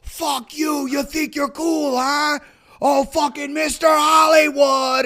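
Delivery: loud, high angry shouting; aggressive heckler. Retold or imitated. Philly guy picking a fight with visiting actor, shouting. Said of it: "and some guy was like".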